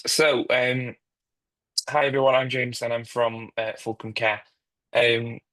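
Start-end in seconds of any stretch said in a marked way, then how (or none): none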